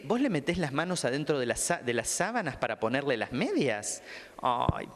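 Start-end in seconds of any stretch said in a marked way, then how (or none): none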